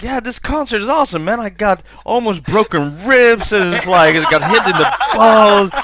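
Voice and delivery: teasing storyteller impression. Co-host parodies the friend's concert story, mock-gushing. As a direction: parody a fan gushing through the pain. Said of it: "it's more like you're telling the story".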